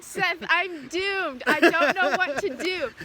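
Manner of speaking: loud, drawn-out wail of doom; mock despair. Student slumped in her chair, wailing to Seth, loud and slow. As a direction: ham up the hopeless student, very slow. Retelling failing the math test.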